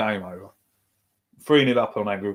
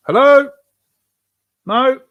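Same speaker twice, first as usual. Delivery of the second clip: a sharp, much higher, louder hello; exasperated shout; 12 semitones higher, 5 dB louder, slower than usual. Real, not acted. Fan yells at the referee during the match, exasperated shout. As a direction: shout it at the ref in disbelief.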